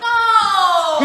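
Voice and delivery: huge, crying shriek; shocked scream. Real, not acted. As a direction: scream in disbelief. Hearing presents are lost.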